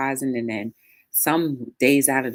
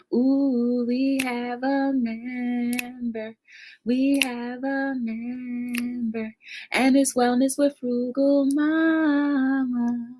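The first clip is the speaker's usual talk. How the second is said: excited sing-song announcement; 9 semitones higher, much slower than usual. Real, not acted. Announcing a new channel member.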